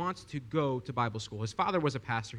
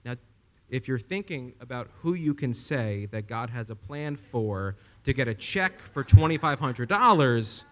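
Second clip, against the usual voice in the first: ordinary talk, nothing marked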